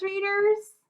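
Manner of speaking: ordinary delivery, nothing marked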